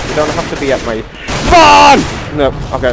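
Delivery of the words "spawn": loud, high-pitched yell on one word; sudden shout. Real, not acted. Player complaining about attackers at spawn, exasperated shout. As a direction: burst out shouting. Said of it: "sorry for shouting".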